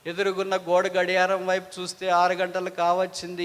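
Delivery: usual speaking voice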